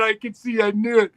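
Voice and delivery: ordinary talk, nothing marked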